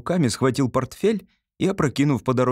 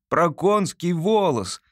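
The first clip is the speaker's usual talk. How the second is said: higher, slow correction; dry correction; 6 semitones higher, slower than usual. Acted. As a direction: repeat it slowly and drily, as the son. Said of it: "сухо повторил сын".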